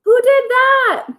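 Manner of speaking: high, startled question; surprised Pete. Acted. Pete woken by noise, high startled question. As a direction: Pete jolted awake asking who did it.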